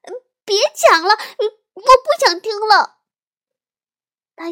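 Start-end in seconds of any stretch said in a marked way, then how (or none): 0.0-3.0 put-on high, loud little-girl protest; loud shout. A narrator's reading. Little sister blocking her ears, loud high shouting. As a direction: shout to make him stop. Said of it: "大声喊道".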